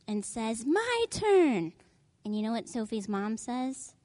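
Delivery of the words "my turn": put-on high, pushy little-kid voice; high bossy little sister. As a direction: demand it like a grabby toddler. Little sister grabbing the gorilla.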